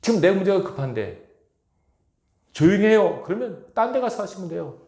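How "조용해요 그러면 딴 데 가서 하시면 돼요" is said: high, loud mock shush; loud complaint. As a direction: snap at someone to keep it down. Neighbours complaining about loud prayer.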